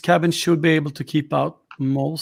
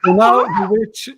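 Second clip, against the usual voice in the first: witch voice; 8 semitones higher, 5 dB louder than usual. Acted.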